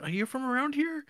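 put-on high creepy man voice; creepy high stranger voice. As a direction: ask like a too-friendly stalker. Man asks woman question.

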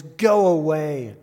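slow, loud, unearthly demon cry; loud, drawn-out demon shriek. Acted. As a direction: draw it out loud like a possessed man. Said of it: "he cried out at the top of his voice".

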